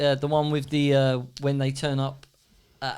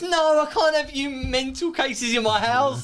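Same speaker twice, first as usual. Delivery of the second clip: higher, slow, indignant voice; mock outraged refusal; 9 semitones higher, slower than usual. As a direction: put on a prim outraged voice. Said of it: "then she comes out and goes".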